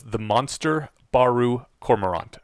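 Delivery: "The Monster Baru Cormorant" said slowly, word by word. Real, not acted. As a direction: spell out the title slowly so the listener catches each name. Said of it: "can you say that a little slower".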